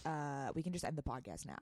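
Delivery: normal talking voice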